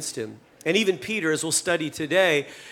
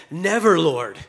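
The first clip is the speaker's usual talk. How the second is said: a hint of a heated rebuke, slow; mild mock outrage grabbing his collar; understated angry protest; red-faced protest; 2 semitones higher, 3 dB louder, slower than usual. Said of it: "red in the face".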